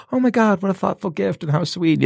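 high-pitched mock girlfriend voice; imitated gushing girlfriend. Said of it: "I've had girlfriends before".

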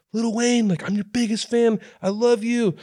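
high, gushing superfan squeal; a starstruck fan begging for notice; gushing fan girl. Retold or imitated. Girl side stage gushing at [name], high squeal. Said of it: "She's like".

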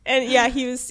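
ordinary talk

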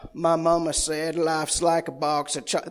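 lightly put-on bad Southern accent; Forrest Gump. Pastor quoting a film to the church, mock Southern accent. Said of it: "At the risk of sounding like Forrest Gump".

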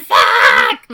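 loud, high mock scream; startled yell. Daughter hit by blaring music, mock startled yell. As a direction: yell it out as if blasted.

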